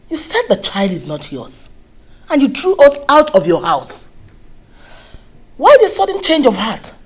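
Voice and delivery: building to loud, high reproach; accuse, rising from firm to shouting; indignant accusation. Acted. Mother accuses repentant young man.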